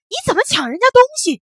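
loud, high, fast yell after a thief; father's shout. Acted. Father yells at the boy who grabbed the toy, loud and fast. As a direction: bellow it at the running boy. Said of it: "他大叫一声".

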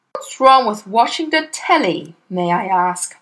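usual speaking voice

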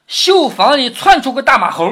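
high, loud comic payoff; blurted punchline. Acted. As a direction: blurt the absurd line just before cracking up. 薛蟠说出荒唐酒令. (高声抖出荒唐包袱)

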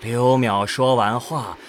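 ordinary talk

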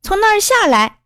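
high, urgent storybook yell; acted shout of command; yelled order. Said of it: "小胡大嚷".

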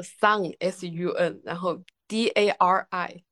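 slow, letter by letter; spelling it out. Shown as a demonstration. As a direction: spell the brand name out slowly. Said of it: "怎么拼？".

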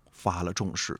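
ordinary delivery

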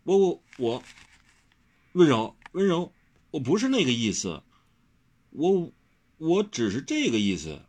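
tongue-tied, flustered protest; flustered stammering. A narrator's reading. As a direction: stammer out a hurried clarification. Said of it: "忙得七嘴磕着八舌".

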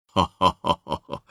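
low, soft belly laugh; deep, easy hearty chuckle; jolly laugh. Acted. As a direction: chuckle like a contented fat Buddha. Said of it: "弥勒佛笑道".

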